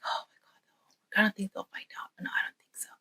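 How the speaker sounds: very soft whispered self-talk; worried whisper. Acted. Boy fears brothers will find out, anxious whisper. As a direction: reassure yourself secretly, under your breath. Boy privately frets about being caught.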